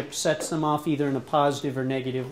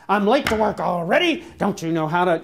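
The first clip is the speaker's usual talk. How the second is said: mock angry husband; 5 dB louder than usual. Husband scolding wife over empty gas tank, exasperated. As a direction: snap at her like you're late for work.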